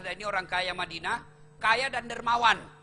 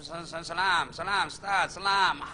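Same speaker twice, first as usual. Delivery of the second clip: mock hinting salam to end the sermon; bored listener urging the preacher to finish; sarcastic salam; 5 semitones lower than usual. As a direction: nag the preacher to wrap up. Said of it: "salamnya nyindir".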